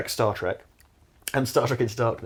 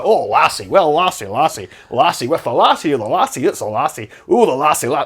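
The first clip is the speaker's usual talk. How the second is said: louder mock Scottish, repeating lassie; mock Scottish accent; 2 semitones higher, 7 dB louder than usual. Podcaster parodying Scotty saying lassie, in a loud mock Scottish accent. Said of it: "keeps saying "fucking lassie".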